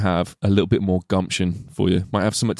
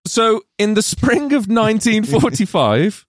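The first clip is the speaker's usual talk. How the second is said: story narration; 4 semitones higher than usual. Acted. Narrates film plot as story.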